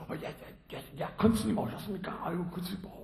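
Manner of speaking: rushed, fast nervous babble; flustered greeting. Acted. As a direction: caught off guard, gabbling. Surprised attendant greeting teacher.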